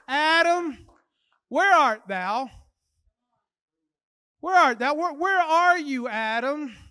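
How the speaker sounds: loud, stretched-out call to Adam; forceful preaching. Acted. Preacher voices God seeking Adam, loud resounding call. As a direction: proclaim it like God calling out.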